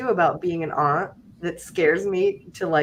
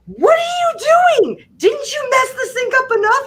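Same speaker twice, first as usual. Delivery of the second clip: high, loud, disbelieving yell; exasperated shout; 4 dB louder than usual. Retold or imitated. Aunt catching the kid peeing in the sink, shouting.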